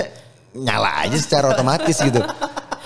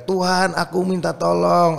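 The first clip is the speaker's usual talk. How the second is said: mock cry for help; 7 semitones higher, slower than usual. Drowning man crying out in story.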